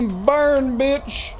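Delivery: high loud battle cry; triumphant yell. Real, not acted. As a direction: roar it as they burn. Setting enemy on fire.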